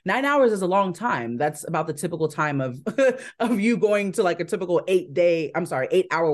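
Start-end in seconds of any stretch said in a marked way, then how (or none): 2.8-3.3 short laugh. Real, not acted. Laughs at her slip.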